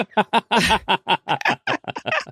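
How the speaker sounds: high laugh. Real, not acted. Laughing at Bob Ross joke.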